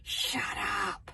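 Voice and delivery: ordinary delivery, nothing marked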